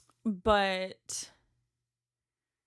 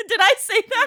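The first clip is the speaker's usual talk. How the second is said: high, loud, laughing question; 14 semitones higher, 13 dB louder, faster than usual. Real, not acted. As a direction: ask it through a burst of laughter. Laughing at herself.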